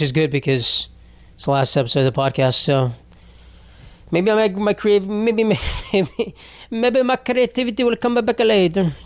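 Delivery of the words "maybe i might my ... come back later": high put-on Italian accent, hammed up; a jokey try at an Italian accent, pitched high; mock Italian accent. Podcaster clowning an Italian accent for his listeners. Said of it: "That was not good Italian".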